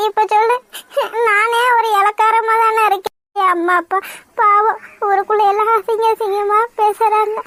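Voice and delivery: tearful confession with sobbing breaths; sobbing out regret between phrases; crying. Acted. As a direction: break down in guilt and self-blame. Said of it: "ஏன் இப்படி அழுகுற".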